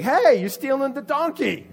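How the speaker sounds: high, loud alarm call; acted indignant yell; accusing shout. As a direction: shout out at a thief caught in the act. Bystander catches donkey thief in story.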